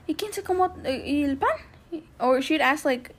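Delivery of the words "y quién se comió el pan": higher, slower mom voice in Spanish. Retold or imitated. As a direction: voice the suspicious mom.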